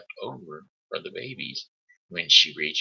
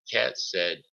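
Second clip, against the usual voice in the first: plain reading voice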